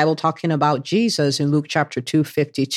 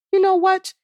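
sudden high-pitched mock concession; imagined God conceding; 14 semitones higher than usual. God pretends to agree with Gideon, mock concession. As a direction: lift it high, as if just persuaded. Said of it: "Maybe God was like".

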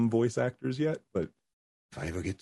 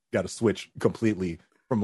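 usual speaking voice

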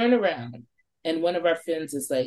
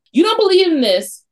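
much higher, louder dare; taunting challenge; 12 semitones higher, 8 dB louder than usual. Retold or imitated. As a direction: throw it back at him, daring. Girls challenging the skeptic.

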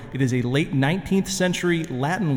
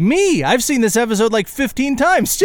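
excited; 8 semitones higher, 4 dB louder than usual. Real, not acted. Admitting ignorance, self-mocking outburst.

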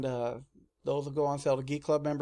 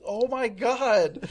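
startled; 8 semitones higher, 4 dB louder, much slower than usual. Retold or imitated. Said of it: "And everybody's like".

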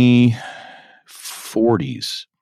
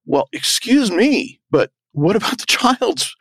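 indignant; 12 semitones higher than usual. Pretend. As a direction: draw it out in disbelief. Mock outrage at privacy rule.

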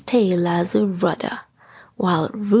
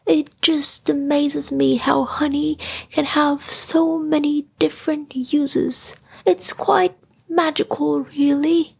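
Pooh voice, higher and full of wonder; marveling Pooh; 6 semitones higher than usual. A narrator's reading. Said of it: "marveled Pooh".

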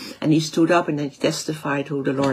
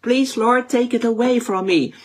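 raised, pleading plea to God; 7 semitones higher than usual. Retold or imitated. Paul begging the Lord to remove the thorn, pleading. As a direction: beg the Lord to lift the burden. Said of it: "he pleaded with the Lord three times".

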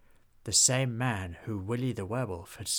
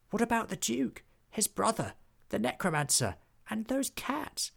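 high, anxious goblin question; Hobgob; 10 semitones higher than usual. A narrator's reading. Hobgob asks friends about the villains, high and anxious. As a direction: squeak out the worried list. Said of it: "asked Hobgob".

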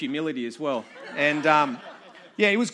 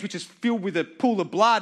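usual speaking voice

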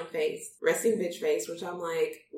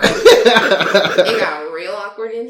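laughing; 16 dB louder, much slower than usual. Real, not acted. Awkward laugh during the chat.

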